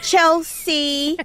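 high, drawn-out half-hearted call; sing-out name call with little effort. Retold or imitated. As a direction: imitate someone lazily calling a dog. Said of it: "like that, half-assed".

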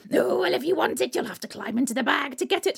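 high coaxing hag, then quick; troll hag. Acted. Troll hag lures Butterball into the bag, coaxing. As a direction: lure him into the bag.

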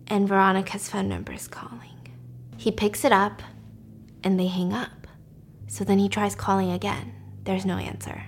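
soft, breathy whispering; suspense whisper. A narrator's reading. Telling the morgue scene, in a tense whisper. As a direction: hush down for the scary part.

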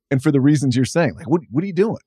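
ordinary talk

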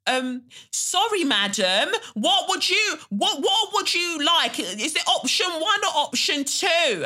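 very high, loud mock-patronizing waiter; mad loud waiter. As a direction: imitate a waiter shouting as if she were deaf. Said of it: "suddenly waiters are talking mad loud".

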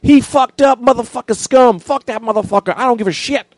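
high, loud mock rant of an angry woman; hammed-up furious woman's voice; angry big lady. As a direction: screech it out as the fed-up woman.

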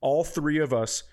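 usual speaking voice

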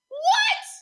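squeaky shocked shriek; surprised. Acted. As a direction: shriek it in mock disbelief. Mock shock at the Emmy.

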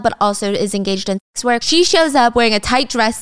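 usual speaking voice

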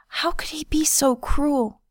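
high, tearful hoarse whisper; wounded, tearful question. A narrator's reading. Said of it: "Her voice became a hoarse whisper".

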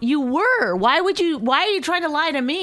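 high, heated accusation; real exasperation, pitched right up; exasperated. Real, not acted. Wife accuses husband of lying to her, exasperated.

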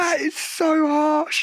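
high, drawn-out whine; high-pitched stretched-out indignation; wounded protest. Real, not acted. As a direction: protest slowly in a high, hurt voice. Wounded reaction to the teasing.

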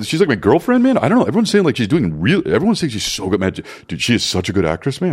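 swooping dude voice, high at first; mock whiny friend. Imitating friend fretting over actress girlfriend, mock whiny bro. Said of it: "he was like".

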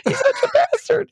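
gleeful laugh while agreeing, voice up high; laughing squeal of agreement. Retold or imitated.

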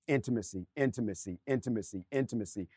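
soft repeated word. Real, not acted. Chanted repetition, fading.

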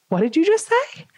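high, incredulous question; can't believe what you just heard; shocked. Retold or imitated. Shocked at waiter's odd remark.

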